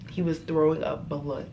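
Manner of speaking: ordinary delivery, nothing marked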